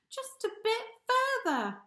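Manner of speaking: a hint of bold hippo, pitch jumping on bit; lightly voiced, determined push on. Hippo boldly answering the camel, mildly determined. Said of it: "he boldly said".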